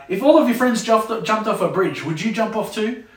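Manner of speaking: mock the kid's logic; a parent sarcastically challenging peer pressure; sarcastic parent. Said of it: "another sarcastic one".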